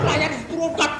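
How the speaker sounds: ordinary delivery, nothing marked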